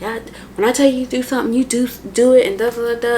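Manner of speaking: imitating him yelling at her, fast at first; angry abuser. Abuser shoving her against the wall, angry yelling.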